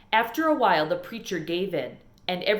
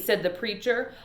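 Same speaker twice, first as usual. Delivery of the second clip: ordinary delivery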